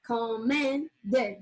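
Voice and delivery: "commended" slowly split as com-men-ded; three drawn-out syllables in "commended". Shown as a demonstration. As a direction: sound out "commended" in three slow, separate beats. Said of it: "has three".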